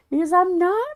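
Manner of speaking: mock protest with the pitch climbing. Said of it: "Yes, you're raising your octave".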